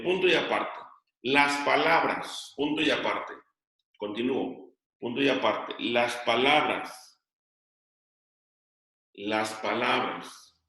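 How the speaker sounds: dictating slowly, word by word; slow dictation. Shown as a demonstration. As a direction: spell it out for students copying it down. Teacher dictating to class. (dictado lento, palabra por palabra)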